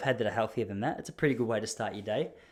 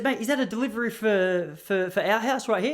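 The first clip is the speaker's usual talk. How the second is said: friendly question; 7 semitones higher than usual. Retold or imitated. Asking the postman about delivery.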